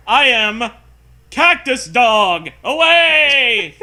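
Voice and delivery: loud, high, slow hammed-up villain cry; hammed-up monster. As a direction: ham up the boss announcing himself. Voicing the monster boss.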